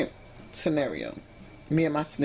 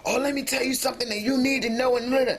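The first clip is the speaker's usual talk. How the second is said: high, pushy mock of a confrontational opener; 8 semitones higher, faster than usual. Mocking the way she refuses to open talks with her partner. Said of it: "I don't go in like".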